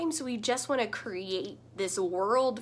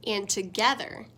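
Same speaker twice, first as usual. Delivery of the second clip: storybook reading. A narrator's reading. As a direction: read it like bedtime story time with little ones. Reads picture book aloud.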